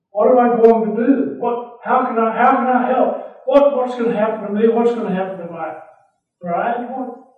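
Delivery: tumbling worried thoughts spilling out; a man in a jam, fretting fast and loud; panicked Joseph. Acted. Joseph's anxious inner voice.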